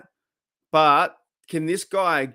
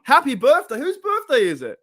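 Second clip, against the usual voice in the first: high, loud burst of surprise; delighted shout that jumps up in pitch; excited birthday cheer; 11 semitones higher, 5 dB louder than usual. Real, not acted. Host spots a birthday in comments.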